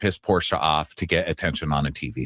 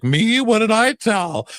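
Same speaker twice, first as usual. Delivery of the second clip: high, slow mock-innocent Angela voice; 5 dB louder, slower than usual. Recapping the reunion, mimicking Angela in a high voice. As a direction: play dumb in a squeaky mock voice. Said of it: "Angela's like".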